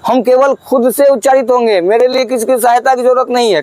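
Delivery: haughty declaration of independence; proud vowel. Acted. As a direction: puff up like the proud big brother. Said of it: "स्वर क्या बोला?".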